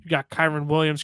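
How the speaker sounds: usual speaking voice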